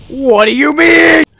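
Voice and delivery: high, slow outraged yell; a real stretched-out yell at the screen; shout. Real, not acted. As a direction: roar it in disbelief. Outraged at a game moment.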